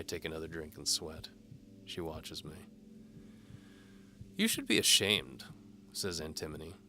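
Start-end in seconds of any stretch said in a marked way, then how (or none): 4.3-5.4 higher, louder teasing lady voice; seductive noblewoman. A narrator's reading. Antimony dares the knight to follow. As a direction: tease the knight like a bold noblewoman.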